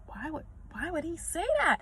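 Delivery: high, soft incredulous question; shocked disbelief. Retold or imitated. Hearing a son call himself brain dead, high hushed disbelief. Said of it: "I was like".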